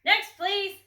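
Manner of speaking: loud, high call; loud dismissal. A narrator's reading. Grocer loudly calling the next customer.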